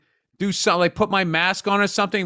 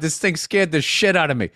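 ordinary talk, nothing marked

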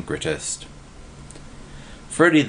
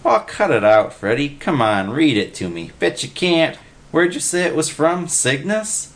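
high, nagging dare; daring Willie; 7 semitones higher than usual. A narrator's reading. Willie daring Freddy to read, high and nagging. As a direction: goad him into reading.

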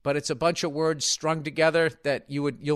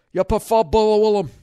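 loud, raised-pitch Scottish imitation; mock Scottish accent; 6 semitones higher, 7 dB louder than usual. Host quoting a Scotsman, loud mock Scottish accent. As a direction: ask it in a hammed-up brogue. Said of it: "Well, he goes".

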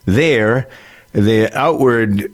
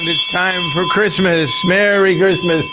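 town crier; 10 semitones higher, slower than usual. Acted. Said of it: "this is the old town".

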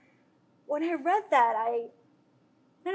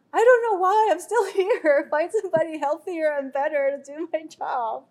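higher, louder, laughing through the words; half-laugh at your own hopelessness; laughing despair; 6 semitones higher, 7 dB louder than usual. Retold or imitated. Retelling a self-doubting talk.

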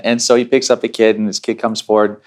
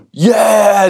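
high, louder eager yell; excited kids; 9 semitones higher, 4 dB louder than usual. Retold or imitated. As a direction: imitate little kids cheering yes. Little kids eagerly answer the offer.

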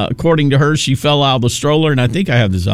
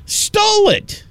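Stole it. leaps way up in pitch, aghast; incredulous outburst; slower than usual. Real, not acted. Host echoing Kamala's theft to listeners, high incredulous outburst. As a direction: spit out Stole it. in outraged disbelief. Indignant echo of the accusation.

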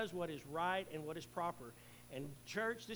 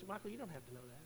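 gentle inner voice of God, softer; a quiet voice heard inside the head; lightly voiced, hushed inner God; God's voice in my head; 2 semitones higher, 6 dB quieter, faster than usual. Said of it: "reminding me".

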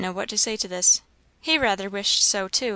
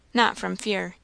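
usual speaking voice

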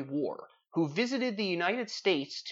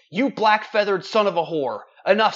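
much louder mock furious outburst, higher; bursting out; 5 semitones higher, 9 dB louder than usual. Poet finally bursting out at the raven, loud mock rage. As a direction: explode at the bird. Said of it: "I did burst".

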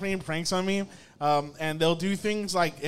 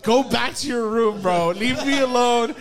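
louder, higher hammed-up telling off; mock angry shouting; 5 semitones higher, 8 dB louder than usual. Boyfriend ordering the kid back to bed, mock shout. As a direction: shout it like a fed-up grown-up, half joking.

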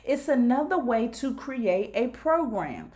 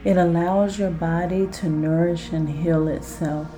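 guided hypnosis; 4 semitones lower, 4 dB louder than usual. Acted. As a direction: lull the listener down into relaxation. Guided self-hypnosis exercise.

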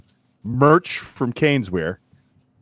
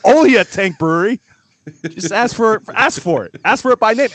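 chipper 1950s ad; 5 semitones higher than usual. Acted. Hyped sponsor read for beer.

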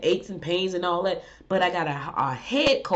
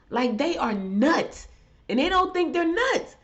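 exasperated disbelief; 9 semitones higher than usual. Real, not acted. Ranting to viewers about lawmakers, exasperated and incredulous. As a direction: pitch shoots way up in outraged disbelief.

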